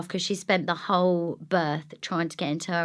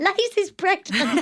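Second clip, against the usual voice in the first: excited announcement; 11 semitones higher, 5 dB louder, slower than usual. Retold or imitated. Mum bursts in announcing news.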